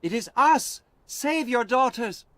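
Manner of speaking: high, loud pleading call; loud high plea. Acted. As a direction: shout up to the wall. Alice calling to the fort.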